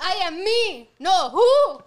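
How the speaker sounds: high and loud, laughing through it; mock confused quote. Friend replaying movie gag, loud laughing mock confusion. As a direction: yell the riddle, half laughing. Quoting Rush Hour who scene.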